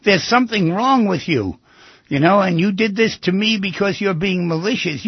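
half-shouted imitation of someone's tirade; mock screaming accusation. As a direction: hurl accusations in a mild mock yell. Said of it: "if people scream at you and say".

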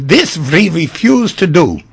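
slow, loud German refusal; German accent. Acted. German doctor refusing the pilot's favor, in an emphatic German accent. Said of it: "pardon my German accent".

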